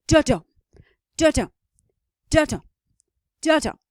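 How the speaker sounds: da-dum with the second beat punched, like train wheels; iambic da-dum rhythm. Shown as a demonstration. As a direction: tap out da-dum on the rails, weak then strong. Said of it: "you might hear da-dum".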